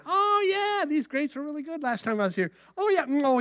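high-pitched happy Samson; delighted recollection. Acted. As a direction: perk up at a tasty memory. Samson spots the vineyard again.

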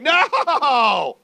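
loud, high playful shout of no; emphatic mock no. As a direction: bark a cheeky no at happy holidays. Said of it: "you're like".

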